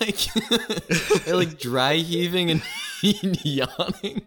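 high, slow, laughing question; words squeezed out through laughter. Real, not acted. Laughing at host's panic.

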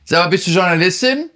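mocking the journalist with a blunt, rude question. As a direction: fire it off rudely, as a parody. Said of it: "Stell dir mal vor, ich wär zu der Frau gegangen".

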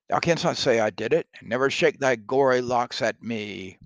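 loud, fast Macbeth to the ghost; hammy stage actor. Acted. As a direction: fling it at the ghost in horror. Teacher reads Macbeth to ghost.

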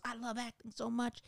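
swooning Southern hopeful; impersonating eager actress. Retold or imitated. Imitating the actress confessing her love of acting, high and soft. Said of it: "best impersonation I can do".

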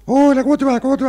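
very high squeaky helium voice; sweet voice pushed way up high. Retold or imitated. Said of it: "Qué horrible".

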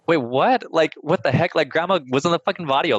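high-pitched, baffled protest; incredulous comeback, quickening at the end; retold indignant disbelief. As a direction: push the pitch up in mock bewilderment. Retold protest to her family.

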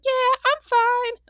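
put-on high, drawn-out, loud kid voice; strained little kid. Pinned little kid answering the neighbor, high strained imitation. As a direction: imitate a little kid insisting through it, slowly.

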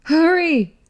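high urgent yell; shouting. A narrator's reading. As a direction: yell at him to hurry. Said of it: "she screamed".

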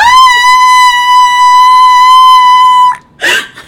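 a loud, very high shriek of laughter. Real, not acted. Reacting to the lamp money story, shrieking laughter. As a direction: burst into a piercing laugh. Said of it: "I'm sorry, headphone users".

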